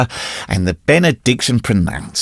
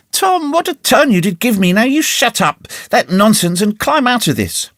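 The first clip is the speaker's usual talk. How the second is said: relieved scolding aunt; 9 semitones higher than usual. A narrator's reading.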